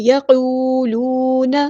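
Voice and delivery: یقولون slow, both u vowels held equally; equal long vowels. Shown as a demonstration. Teacher models the madd, two even long vowels in یقولون. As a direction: stretch qoo and loo evenly in یقولون. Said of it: "دونوں جگہوں پہ برابر لمبا کرنا ہے".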